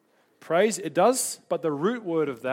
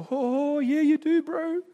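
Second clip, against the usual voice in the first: high, sing-out Kiwi bodyguard; Kiwi accent; 10 semitones higher, 4 dB louder, slower than usual. Retold or imitated. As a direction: imitate a Kiwi bodyguard, high-pitched and amused. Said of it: "Got my Kiwi accent on".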